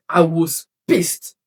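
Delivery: ordinary talk, nothing marked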